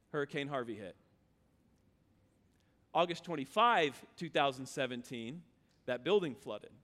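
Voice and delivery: low, soft, somber retelling; hushed somber. Real, not acted. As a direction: drop the voice for the disaster. Recounting hurricane flooding.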